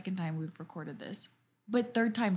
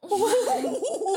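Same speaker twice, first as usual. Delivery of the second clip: loud high cackle; real giggle fit, high and loud; high burst of laughter; 6 dB louder than usual. Real, not acted. Laughing after fart joke.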